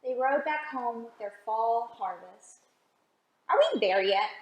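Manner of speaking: put-on storybook read-aloud for little kids; gentle picture-book narration; reading to children.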